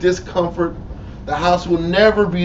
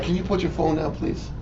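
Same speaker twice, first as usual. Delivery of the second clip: restrained request; 9 dB quieter than usual. Acted. Acted request to phone user.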